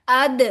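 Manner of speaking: अद with a bounced final द; अद, last consonant popped loose; qalqala bounce. Shown as a demonstration. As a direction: release the द of अद in a short echo. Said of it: "जिनके ऊपर कलकला करते हैं".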